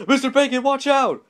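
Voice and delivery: loud, very high urgent holler; yelled warning. A narrator's reading. Mister Yell warning the butcher of a prank, yelled warning. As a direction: yell to warn of danger. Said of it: "Mister Yell yelled".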